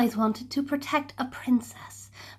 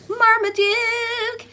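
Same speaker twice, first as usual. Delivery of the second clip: high, slow, ringing name; storyteller's triumphant call; excited reveal; 11 semitones higher, 4 dB louder, slower than usual. A narrator's reading. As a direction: announce the hero. Hero revealed.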